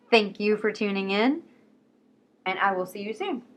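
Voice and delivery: usual speaking voice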